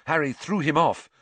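usual speaking voice

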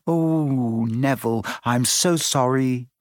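ordinary delivery, nothing marked

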